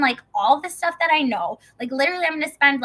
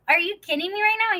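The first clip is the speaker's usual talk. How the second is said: high-pitched disbelief; retold amazement, voice jumping up; incredulous; 8 semitones higher than usual. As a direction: react with astonished disbelief. Disbelieving reply to God retold.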